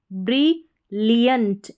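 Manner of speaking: Brilliant drawn out, every syllable clear; slow model word. Shown as a demonstration. Teacher models the word for students, slowly.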